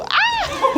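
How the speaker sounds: sudden high shriek; startled scream. Real, not acted. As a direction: yelp of surprise mid-lift. Scream while lifting mattress.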